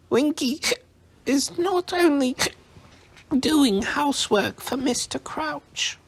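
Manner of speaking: very high, offended elf squeak; indignant squeak. A narrator's reading. Drunk house elf protesting to Harry, indignant squeak. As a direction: squeak it out, affronted. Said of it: "Winky squeaked indignantly".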